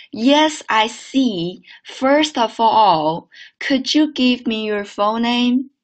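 slow model reading with "First of all" and "could you" joined; linked sentence. Shown as a demonstration. Teacher joining the whole sentence for students, linked reading. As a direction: link "could you" like "could-joo", slowly. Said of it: "好了，整个句子连起来".

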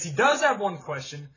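ordinary talk, nothing marked

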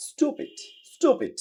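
"স্টুপিড" said high with plain stoo; "স্টুপিড" as American stoopid, no y; American stupid. Shown as a demonstration. Teacher models American pronunciation of stupid, plain stoo. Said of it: "আমেরিকানরা এটাকে বলতেছে".

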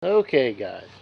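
ordinary talk

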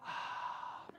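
long, audible exhale; voiceless puff of air; demonstrated breath out. Retold or imitated. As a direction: breathe out slowly to show your breath. Child shows her visible breath.

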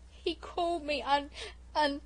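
put-on high, loud, slow sobbing child. A narrator's reading. Hurt little sister sobs to Bobbie. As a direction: blubber it out, choking on the word. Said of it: "sobbed Phyllis".

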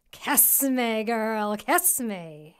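a grim head begging from the stream; eerie creature voice. A narrator's reading. Third, worst head speaks.

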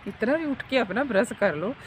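usual speaking voice

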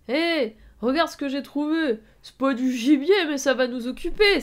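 loud, high, boisterous call to a crony; gleeful guffawing villain. A narrator's reading. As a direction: crow loudly over a find. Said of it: "s'esclaffa-t-il". (cri de méchant hilare, fort et aigu)

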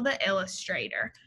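ordinary talk, nothing marked

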